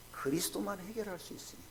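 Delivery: ordinary talk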